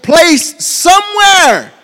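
high drawn-out shout; preaching shout. Real, not acted. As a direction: belt the word out to the back row. Preacher's climax on one word.

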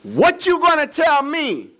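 high, loud, slow challenge; defensive. Acted. Husband confronting his wife, defensive and indignant. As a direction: throw it back at her.

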